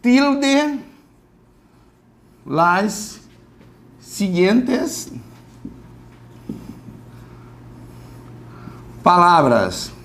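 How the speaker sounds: "tilde las siguientes palavras" read slowly in Spanish; spaced-out exercise reading. Shown as a demonstration. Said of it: "um exercício que fale assim".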